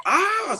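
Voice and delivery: very high mock scream from a shock; retold scream. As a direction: yelp it out as the jolt hits.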